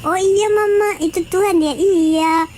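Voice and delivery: put-on high, curious child's questions; a small child asking mama. Child asks mama why Jesus wears no clothes, high and wondering. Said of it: "katanya kan".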